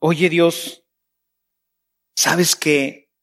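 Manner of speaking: tender talk with God; intimate prayer. Shown as a demonstration. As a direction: lean in and tell your dad a secret.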